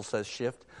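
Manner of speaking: lively read-aloud voicing Shift and Puzzle; animated storybook reading of the ape and donkey; story reading. Preacher reads Narnia story aloud.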